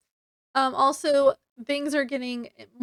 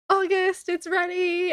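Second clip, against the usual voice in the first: excited call; 6 semitones higher, 4 dB louder than usual. Retold or imitated. Calling August, potion finished.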